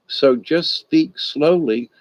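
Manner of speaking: stretched-out slow words; drawn-out slow request; slow, deliberate. Retold or imitated. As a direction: spell it out slowly for a fast talker. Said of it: "she would speak too fast".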